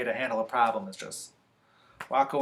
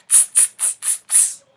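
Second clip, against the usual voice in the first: short voiceless monkey hisses; monkey chatter; 4 dB quieter than usual. Acted. Monkeys answer the scolding peddler, sharp voiceless tsks. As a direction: tss out a row of quick monkey noises.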